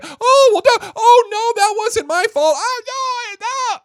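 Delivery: mock a sniveling child denied a trip; whiny child. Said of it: "little Timmy's like".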